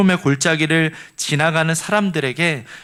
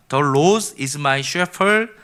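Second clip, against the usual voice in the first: slow English reading; much slower than usual. Shown as a demonstration. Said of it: "이렇게 되어 있거든요".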